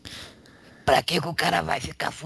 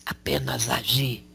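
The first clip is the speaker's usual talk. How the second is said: ordinary talk, nothing marked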